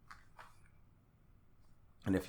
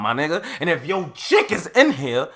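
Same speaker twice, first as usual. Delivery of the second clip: ordinary talk, nothing marked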